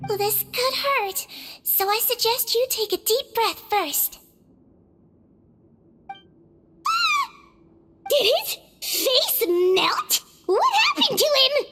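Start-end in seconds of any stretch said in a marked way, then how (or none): 6.1-11.7 shocked gasp. Acted. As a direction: recoil in horror at the sight. Shocked at melted face.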